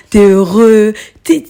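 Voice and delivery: ordinary delivery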